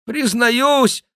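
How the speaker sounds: high, loud penitent voice; put-on voice of a sham king; mock-king confession. A narrator's reading. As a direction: confess loudly, high above your own voice. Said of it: "продолжал мнимый Генрих".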